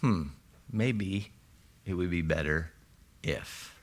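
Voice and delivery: soft, gentle musing, fading to a whisper; loving father. Retold or imitated. Preacher voices a loving father gently advising, softly. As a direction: coax it like a kind father suggesting. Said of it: "hear a loving father saying".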